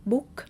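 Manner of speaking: book said with a clear k at the end; final k sounded. Shown as a demonstration. Teacher showing English book, final k sounded. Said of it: "with the sound k".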